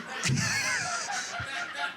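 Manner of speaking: very high, squealing laugh; shrieking laugh. Real, not acted. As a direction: lose it with a shriek.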